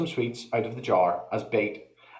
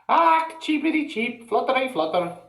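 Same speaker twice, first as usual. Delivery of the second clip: much higher, hammed-up chirping cry; yelled birdy call; 3 dB louder than usual. Feagle baiting the cat, high mock bird cry. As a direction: ham up a helpless wee bird. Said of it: "it yelled".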